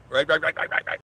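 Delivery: high, loud rapid rights; playful rapid repetition. Real, not acted. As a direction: rattle it off playfully.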